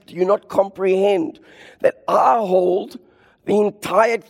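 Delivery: God's voice building from gentle plea to a booming high cry; voicing God, rising. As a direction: start pleading, swell to a high shout on own and hold. Preacher voicing God, building intensity.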